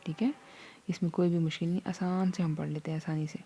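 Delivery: usual speaking voice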